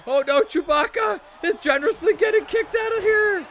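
high, loud play-by-play shout; excited commentary. Real, not acted. Streamer calling Chewbacca getting kicked out, excited shout. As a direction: shout the elimination, pitch way up. Streamer calls wrestler being eliminated.

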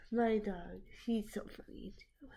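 soft breathy aside, unvoiced; whisper. Real, not acted. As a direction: whisper it to yourself. Aside while watching.

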